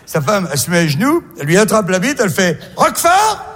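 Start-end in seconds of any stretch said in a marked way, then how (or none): 2.8-3.5 high, surprised guess; surprised question. Acted. Wife guessing the taste in the dark, surprised.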